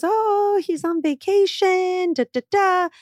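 high, slow put-on voice brushing it off; airy fib, pitched high; evasive tailor. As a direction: imitate the tailor dodging the question.

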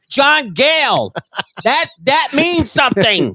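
high, slow put-on gang voice; mock gang member. Host inventing neighbors' gang names, high slow mock voice. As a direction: draw it out high in a hammed-up character voice. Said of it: "what are some of their gang names".

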